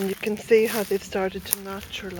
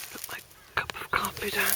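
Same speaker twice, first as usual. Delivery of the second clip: quick whispered note to herself; whispered aside; 6 dB quieter, faster than usual. Real, not acted. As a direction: whisper it, a little fast. Aside while stopping.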